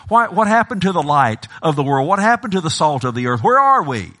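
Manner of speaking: high, urgent challenge to the church; impassioned preaching. Acted. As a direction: preach at full force, question after question. Preaching at full force.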